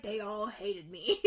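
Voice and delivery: slow, lightly put-on woeful voice; mock self-pity. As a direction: drag it out as a joke. Mimics Goob's reply, then laughs.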